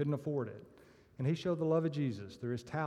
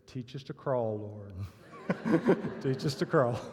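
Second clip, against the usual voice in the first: a deep-voiced plea that breaks into laughter; backpedalling churchgoer, lower and laughing; 3 semitones lower than usual. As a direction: deliver the punchline low, then crack up. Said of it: "a deep voice said".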